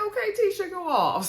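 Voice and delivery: high-pitched, teasing hype; playful cheering on. Retold or imitated. Viewer hyping up Tisha's comeback, high playful cheer. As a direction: cheer her on in a high, sing-out voice.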